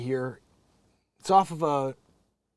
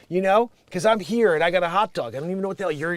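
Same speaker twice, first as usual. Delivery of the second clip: ordinary delivery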